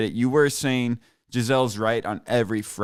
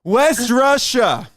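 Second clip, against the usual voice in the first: loud, high quiz-show style rejection; emphatic shouted correction; 11 semitones higher, 7 dB louder, much slower than usual. Acted. Host overruling the caller's answer, loud shouted correction. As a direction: shout the verdict, stretching the words out.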